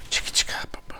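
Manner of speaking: breathy unvoiced drumroll chant; whispered chicka chicka. Host whispers a drumroll to viewers before revealing fabric.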